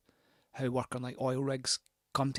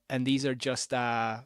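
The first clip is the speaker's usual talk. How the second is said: ordinary talk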